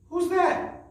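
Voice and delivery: squeaky high guessing-game question; high quiz voice. Shown as a demonstration. Teacher quizzing children in a high playful voice. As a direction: ask the kids like a playful puppet.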